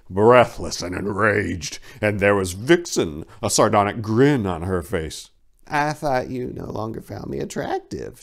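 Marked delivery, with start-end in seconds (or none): 5.7-8.2 soft, sly teasing. A narrator's reading. Vixen taunting Prancer in the clearing, soft sly tease. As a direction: taunt him quietly, a little coy. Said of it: "she teased".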